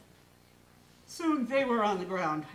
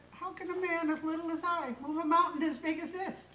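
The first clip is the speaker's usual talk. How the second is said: Minglo's voice; 7 semitones higher than usual. A narrator's reading.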